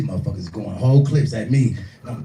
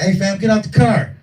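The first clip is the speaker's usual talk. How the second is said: mock yell; 9 semitones higher, 5 dB louder than usual. Driver yelling retold.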